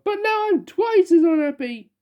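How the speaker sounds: high, tearful wail. Acted. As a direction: keep wailing miserably. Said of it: "he wailed".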